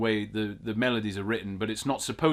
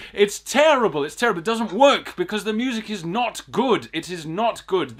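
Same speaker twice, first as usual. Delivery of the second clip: higher and louder indignant rant; outraged; 7 semitones higher, 5 dB louder than usual. Real, not acted. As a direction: vent loudly at the music. Rising outrage about the music.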